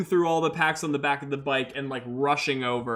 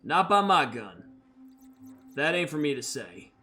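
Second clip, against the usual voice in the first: Red R, solemn bounty hunter. Acted. Red R claiming Danica as prisoner, solemn and cold. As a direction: pronounce the sentence with cold authority. Red R's formal arrest speech.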